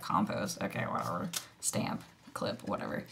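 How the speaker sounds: low, soft, half-swallowed shrug of a voice; dismissive mumble. Retold or imitated. Imitating her careless first day, a low dismissive mumble. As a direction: brush it all off as unimportant.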